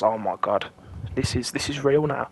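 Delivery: low, breathy retold "oh my God"; mild hushed disbelief; hushed panic. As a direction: say it under your breath, awed and scared. Retold panic, first real drugs.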